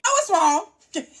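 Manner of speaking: normal talking voice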